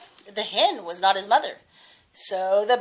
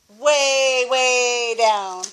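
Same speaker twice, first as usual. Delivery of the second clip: usual speaking voice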